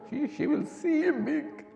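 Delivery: voice breaking into tears. Real, not acted. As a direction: break down mid-sentence. Voice breaks repeating the line.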